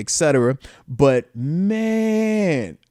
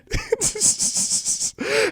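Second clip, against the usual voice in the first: airy laugh; 4 dB quieter than usual. Real, not acted. Laughing at his own jersey woes.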